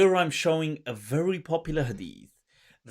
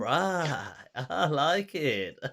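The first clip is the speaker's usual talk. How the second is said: mock approval; 3 semitones higher, slower than usual. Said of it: "I was like".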